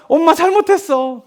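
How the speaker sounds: slow, high pleading imitation; apologising child. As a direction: drag out a child's mock apology. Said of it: "애가 또 어떻게 합니까?". (또 잘못을 비는 아이 흉내)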